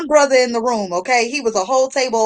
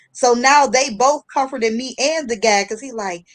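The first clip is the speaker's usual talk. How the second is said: ordinary talk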